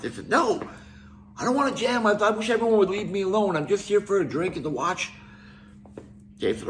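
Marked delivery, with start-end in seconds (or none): 0.3-5.1 higher-pitched irritated snap, imitating Lars; mock-irritated brush-off, pitched up; snapping. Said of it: "Lars snapped".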